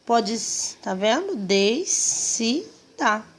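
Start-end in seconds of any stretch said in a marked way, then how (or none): 1.4-3.2 "Descida" slow, in three beats des-ci-da; drawn-out syllables in "Descida"; syllables separated. Shown as a demonstration. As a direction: sound out "Descida" piece by piece. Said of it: "Vamos separar a descida".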